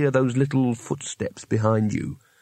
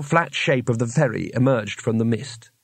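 plain reading voice

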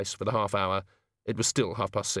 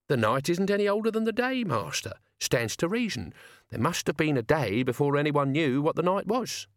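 put-on higher-pitched old servant's voice; argumentative Albert; 6 semitones higher than usual. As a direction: contradict the master, pitched up.

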